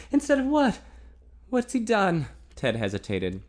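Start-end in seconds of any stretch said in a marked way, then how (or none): 0.1-2.3 higher, louder alarmed woman; worried young woman. A narrator's reading. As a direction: blurt out the fear. Female character voiced.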